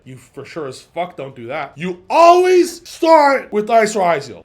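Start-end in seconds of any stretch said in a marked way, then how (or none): 1.8-4.4 higher, louder, slow insistent statement; emphatic loud rule. Real, not acted. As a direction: drill the rule in. Stating the key rule.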